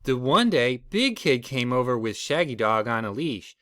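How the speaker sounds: usual speaking voice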